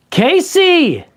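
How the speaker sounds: high excited call of a name; excited shout-out. Real, not acted. Calling out to chat donor.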